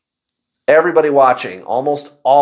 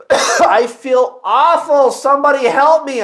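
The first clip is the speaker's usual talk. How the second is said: higher, half-laughing plea for help; mock despair; 10 semitones higher, 4 dB louder, slower than usual. What he wished he'd told coworkers, mock pleading. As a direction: play up the desperation lightly. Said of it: "what I really wanted to tell them was".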